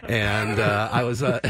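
normal talking voice

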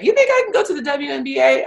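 high, fast hopeful question; light, wide-eyed disbelief; imitating a stunned player; surprised player.

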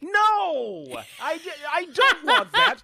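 high, loud cry through laughter; exasperated protest. Real, not acted. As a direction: protest at the top of your voice, half laughing. Mock-outraged protest at co-host's tease.

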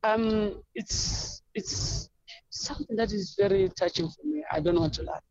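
holding back tears, barely voiced; getting emotional. Real, not acted. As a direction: voice catching, words half breathed out. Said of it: "I'm getting emotional now".

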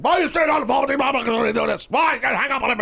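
high, loud mock yell of an annoyed boss; mock angry boss. Host imitating his angry boss yelling about the dead call. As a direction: ham up the boss scolding you. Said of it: "Mister Pimento's gonna get so mad".

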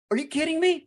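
retold gasp of disbelief, pitched very high; a squeaky, incredulous re-enactment; mock-stunned exclamation; amazed disbelief. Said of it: "And I was like".

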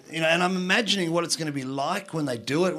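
ordinary talk

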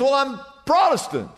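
high-pitched joke character reply; hammed-up jumper; acted earnest answer. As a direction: answer back with voice way up.

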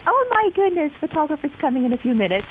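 high, fast panicky inner voice; panicked. Retold or imitated. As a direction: replay the moment of panic. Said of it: "because I'm thinking".

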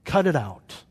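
acted forceful rebuke, slowing for emphasis; forceful exasperation. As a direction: scold them as if they should know better. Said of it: "could be so forceful and say".

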